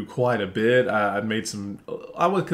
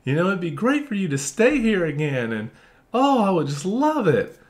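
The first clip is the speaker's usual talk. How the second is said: mock sugary sweet; 6 semitones higher than usual. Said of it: "that's so different from me saying".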